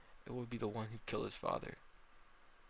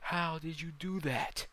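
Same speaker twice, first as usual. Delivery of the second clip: slow, high, loud yell; drawn-out, raised shout; 7 semitones higher, 6 dB louder, slower than usual. Acted. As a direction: yell it out in disbelief.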